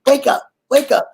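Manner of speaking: mock aunt shaking the dead awake; imitating the aunt. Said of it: "she'd be slapping them".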